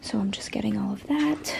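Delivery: hushed unvoiced whisper; quiet whispered aside. Real, not acted. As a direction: breathe the words out quietly. Whispering while gathering devices.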